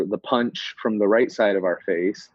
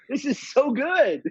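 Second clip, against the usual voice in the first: much higher, gleeful; delighted; 13 semitones higher than usual. Real, not acted. Theorist delights in little Billy's lying, gleeful. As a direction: gush with delight.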